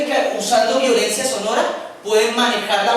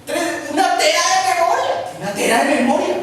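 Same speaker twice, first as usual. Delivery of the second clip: emoción burlona; 7 semitones higher than usual. Acted. Alguien enamorado de un celular.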